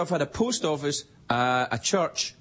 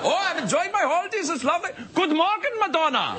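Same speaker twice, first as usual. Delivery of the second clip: high cheery mock German accent; German tourists; 13 semitones higher than usual. Tourists greeting [name], cheery mock German accent. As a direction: chirp it like a hiker in lederhosen. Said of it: "German tourists in lederhosen".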